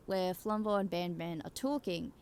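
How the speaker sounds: ordinary talk, nothing marked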